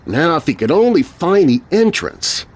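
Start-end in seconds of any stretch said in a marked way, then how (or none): none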